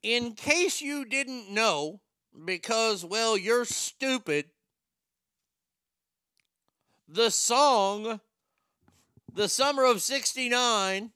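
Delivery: loud, high, drawn-out showman delivery; hammed-up radio bit voice; mock explainer. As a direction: announce it big, pitched well up, for shock value.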